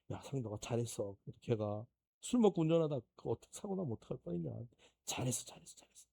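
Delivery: God's gentle praise. Pretend. God comforting the pastor after his good deed, soft and tender. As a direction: soothe him like a proud father.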